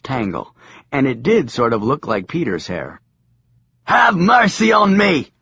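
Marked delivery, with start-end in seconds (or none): 3.8-5.3 high pleading madman voice. Acted. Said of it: "said the madman".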